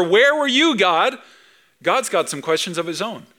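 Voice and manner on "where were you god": high, loud accusing question; Job's complaint. Retold or imitated. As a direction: Job flinging the question at God. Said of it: "Job's questions were".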